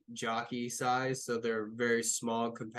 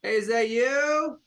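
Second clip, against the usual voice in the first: loud, high questioning call; 9 semitones higher, 8 dB louder than usual. Acted. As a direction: call across the ship to find someone. Calling out on the empty ship.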